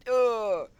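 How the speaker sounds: loud high pained grunt. Retold or imitated. Kid punched in the jaw, loud high grunt. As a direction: cry out from the punch.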